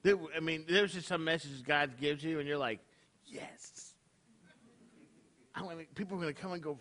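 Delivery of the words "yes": breathy whispered "Yes.", barely audible. Retold or imitated. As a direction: whisper it like a private triumph. Inner yes to a great message.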